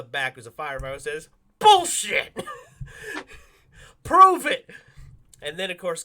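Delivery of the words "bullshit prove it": loud high New York heckle; New York accent. Retold or imitated. Firefighter in the back heckling Conroy, loud mock New York accent. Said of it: "thickest New York accent".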